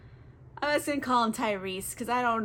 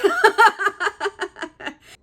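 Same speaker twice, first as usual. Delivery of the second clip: laugh; 10 semitones higher, 9 dB louder than usual. Real, not acted. Laughs drunk.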